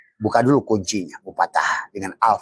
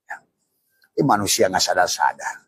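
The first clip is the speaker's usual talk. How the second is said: ordinary talk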